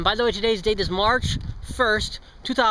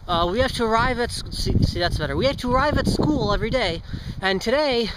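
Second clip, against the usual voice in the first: usual speaking voice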